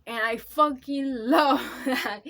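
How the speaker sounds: loud, slow, laughing through the words; bursting with love for the book, half laughing; emotional laugh-shout at the camera; overwhelmed laughing outburst. Real, not acted. Passionate rant about the plot.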